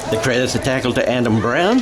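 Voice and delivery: ordinary talk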